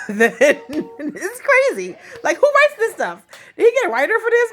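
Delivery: can't believe this story, laughing; laughing disbelief. Real, not acted. Vlogger mocking OJ's tape, high laughing disbelief. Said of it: "reacting to absurd tape".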